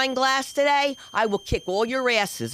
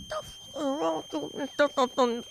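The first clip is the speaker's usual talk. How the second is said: muttered threat; 3 semitones higher, 6 dB quieter, slower than usual. Retold or imitated. Mother to daughter mid-audition, muttered threat. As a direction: mutter it slowly through closed teeth.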